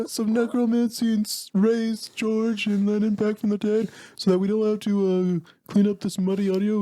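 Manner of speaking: accidental cartoon-Scandinavian voice, pitched high; a British attempt sliding into a high puppet voice; Swedish Chef. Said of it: "He did it, the Swedish Chef".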